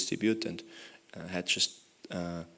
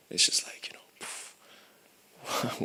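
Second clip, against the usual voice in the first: whispered exasperation; 4 dB quieter, much slower than usual. Retold or imitated. Exasperated sigh at her life.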